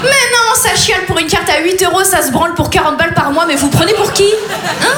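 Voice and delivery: faux scandale, haut au départ; indignation jouée. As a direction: engueuler le client pour rire. Joue le banquier scandalisé par le client. (indigné pour de rire)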